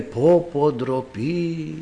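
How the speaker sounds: low, fading acted lament; disappointed. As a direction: let it sink away in disappointment. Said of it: "απογοητεύτηκε". (απογοητευμένη φωνή γιαγιάς, χαμηλόφωνα)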